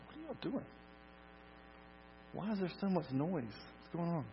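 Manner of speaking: high, soft, baffled questions; puzzled questioning. Acted. Jesus walking in on noisy mourners, puzzled.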